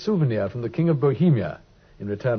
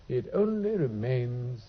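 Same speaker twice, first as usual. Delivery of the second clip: drifting off; 2 semitones lower, 7 dB quieter, slower than usual. Acted. Holmes drifting into thought.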